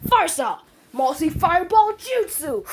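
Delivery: high-pitched, heroic attack cry; attack call. Acted. Naruto jumping back to launch fireballs, calling out. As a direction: call out the special move.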